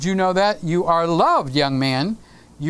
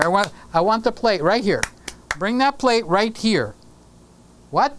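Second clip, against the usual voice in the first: ordinary talk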